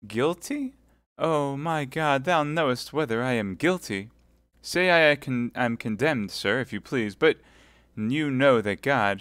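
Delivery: high, pleading martyr; wounded innocence. Acted. Milady pleading her innocence to Felton. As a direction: protest innocence with a smile.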